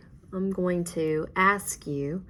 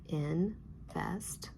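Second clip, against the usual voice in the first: soft "Invest" as in, pause, vest; tapped-out syllables; 8 dB quieter than usual. Shown as a demonstration. Teacher tapping out "Invest" for pupils, syllable by syllable. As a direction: give each syllable of "Invest" a soft beat. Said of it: "Let's tap it out".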